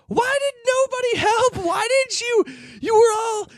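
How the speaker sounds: very high-pitched imitated whine; mock distraught wail. Woman scolding passengers afterwards, mock distraught. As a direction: wail it in a squeaky, put-on high voice. Upset woman confronts the bus riders.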